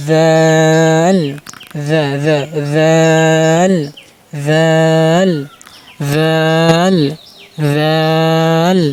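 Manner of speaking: slow chanted জাল, starting on a voiced th; tongue-tip letter demo. Shown as a demonstration. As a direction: hold the vowel of জাল long and level. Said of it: "দুই দাঁতের আগার সাথে আপনার জিহ্বার আগাটা লাগাবেন".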